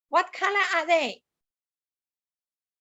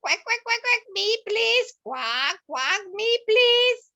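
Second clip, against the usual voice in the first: higher quacky duck voice begging for a turn; quacking duck; 6 semitones higher than usual. Acted. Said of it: "And Missus Duck says".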